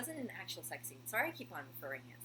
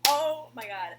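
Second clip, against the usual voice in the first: loud, high burst of shock; mock shocked exclamation; 5 semitones higher, 14 dB louder, slower than usual. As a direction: exclaim it like a stunned viewer. Her own shocked reaction, reenacted.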